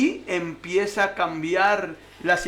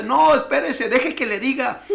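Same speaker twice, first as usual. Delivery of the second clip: laughing commander; 4 semitones higher, 4 dB louder than usual. Retold or imitated. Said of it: "le dice el comandante riéndose".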